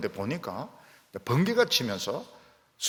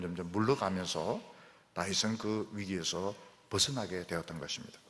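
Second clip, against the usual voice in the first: ordinary talk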